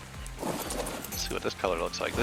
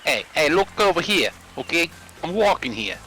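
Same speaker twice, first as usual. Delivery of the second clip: put-on brash New York accent, pitched up at first; mock New Yorker; 4 semitones higher than usual. Gamer clowning as a New Yorker for his friend, mock New York accent. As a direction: play a pushy New York pedestrian. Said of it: "New Yorker".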